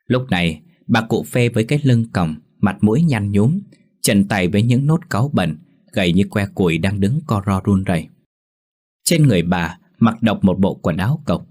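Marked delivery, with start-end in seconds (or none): none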